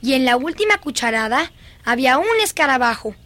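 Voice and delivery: ordinary delivery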